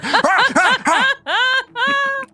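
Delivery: high, loud creature cries; repeated high "ah" from a gaping monster; door monster's cry. Acted. Door wiggling forward with its big mouth, high monster cries. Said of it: "wiggling forward with his big mouth, just going".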